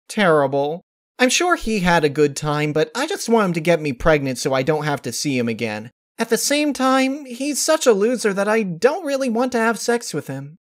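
high, frank and matter-of-fact; blunt Pinkie voice. A narrator's reading. Pinkie venting to Rarity about her date, high and blunt. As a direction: complain about the date with no filter.

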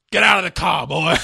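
loud, abrupt mock yell; angry shouted order. Acted angry order in retold cartoon.

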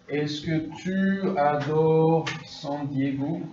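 slowed right down, every syllable spaced out; drawn-out teacher demo of the question; slow model question. Shown as a demonstration. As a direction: model it slowly for the class to copy. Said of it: "Par exemple".

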